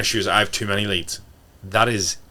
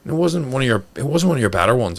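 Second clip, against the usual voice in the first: higher, softened, tiptoeing critique; a hint of a polite friend softening feedback; mock gentle criticism; 4 semitones higher than usual. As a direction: sugarcoat it mockingly. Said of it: "than go".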